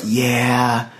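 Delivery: low, slow drawn-out yeah; light imitation of a laid-back low drawl; SoCal Mike. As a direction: drop the pitch and draw it out. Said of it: "who's ever heard SoCal Mike knows this".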